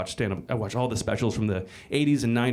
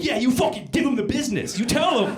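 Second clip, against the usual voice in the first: cheering bro; 12 semitones higher, 3 dB louder than usual. Retold or imitated. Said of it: "the other half of you are like".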